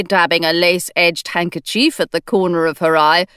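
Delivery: usual speaking voice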